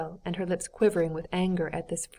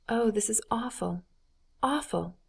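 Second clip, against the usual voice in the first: higher-pitched, hushed dismay; distressed dismay; 4 semitones higher, 3 dB quieter than usual. A narrator's reading. Dolly pitying the betrayed husband, distressed dismay. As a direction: let the repeated word sink in, appalled.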